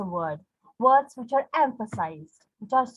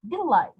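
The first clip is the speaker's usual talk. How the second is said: "delight" with LIGHT high and falling; stress on second syllable; 6 semitones higher than usual. Shown as a demonstration. Teacher putting the iamb back together, second syllable stressed. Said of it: "stressed, light".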